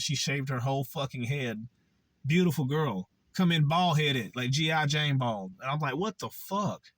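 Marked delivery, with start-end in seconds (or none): 5.9-6.9 pitched up, stunned what the fuck; shocked disbelief. Retold or imitated. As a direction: recoil in disbelief at the sight. Disbelief at girl's shaved head.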